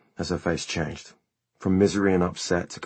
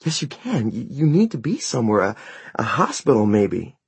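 desperate insisting; 5 semitones higher, 4 dB louder than usual. Acted. Mother pleading, insisting.

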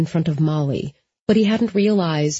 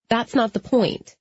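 higher-pitched, dismissive retort; scoffing; 5 semitones higher than usual. A narrator's reading. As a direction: brush the remark aside impatiently. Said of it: "Molly scoffed".

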